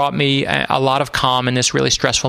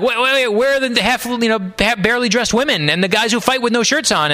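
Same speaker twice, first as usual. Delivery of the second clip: higher-pitched, baffled crowd voice; mock disbelief; 7 semitones higher than usual. Troops asking where the cheerleaders and fighters are, in mock disbelief. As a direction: imitate a let-down audience demanding an explanation.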